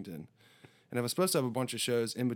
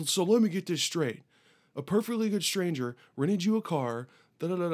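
higher-pitched disbelieving recap; mock skeptical guard; 5 semitones higher than usual. Border guard doubting the rented car story, mock incredulous. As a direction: imitate an official who doubts the story.

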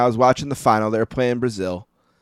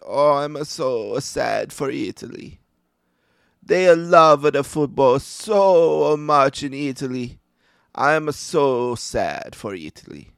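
put-on slow, sorrowful old Italian nonna voice; tearful old Italian grandmother; 3 semitones higher, slower than usual. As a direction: drag out each word like a grieving old lady. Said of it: "my great-grandmother is crying".

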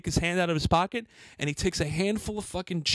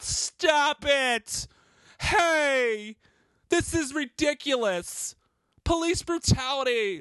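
mock bystander again; 8 semitones higher, much slower than usual.

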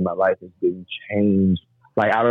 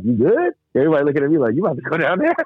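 raised voice, asking around; curious questioning; 6 semitones higher, faster than usual. Retold or imitated. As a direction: ask it with nosy concern. People asking in retold scene.

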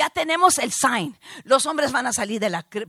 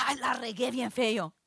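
soft, sheepish thought to oneself; dismayed aside; 2 semitones lower, 10 dB quieter than usual. Retold or imitated. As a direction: drop to a quiet rueful aside. Retold dismayed thought to herself.

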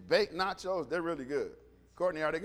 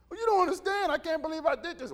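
high-pitched, flustered excuse-making; mock-distressed sinner; distraught protest; 4 semitones higher, faster than usual. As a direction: plead it higher, words rushing out.

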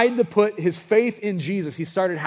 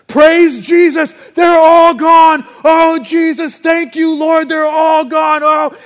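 high, full-voiced cry of relief; jubilant praise shout; 12 semitones higher, 13 dB louder, slower than usual. Freed man thanking Jesus, ecstatic high shouting. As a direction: shout praise at the top of your voice.

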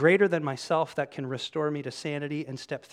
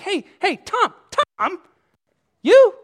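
high, loud call after a friend; 16 dB louder than usual. Acted. Disciples see their friend Tom leaving, calling out. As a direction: call out high to someone walking off.

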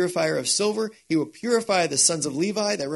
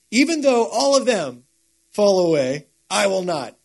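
bold declaration; 3 semitones higher, 6 dB louder than usual. Acted. Peter boasting he won't fall away.

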